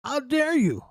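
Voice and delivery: high, indignant caricature of an angry Southerner; voicing angry segregationists, hammed-up indignation; mock outraged Southerner. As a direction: lampoon their outrage, pitched well up. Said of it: "They're like".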